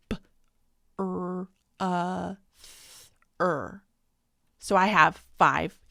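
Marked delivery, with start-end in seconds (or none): 0.1-3.8 "b", "r", "uh", "th", "er" each said as a separate sound; phonemes sounded out. Shown as a demonstration. Teacher isolates each phoneme of brother for students. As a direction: segment brother sound by sound with pauses. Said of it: "I'm gonna hear".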